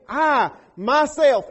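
"I, myself" slow and loud, each word weighted; emphatic I. Acted. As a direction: lean hard on "I, myself". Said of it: "that I is emphatic".

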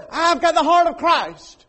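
high, loud, slow, self-satisfied; mock pious claim. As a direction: parody a smug churchgoer.

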